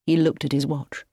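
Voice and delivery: ordinary delivery, nothing marked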